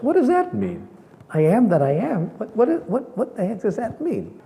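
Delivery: put-on high, childish what what; a confused kid asking the priest; puzzled child. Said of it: "I said to the priest".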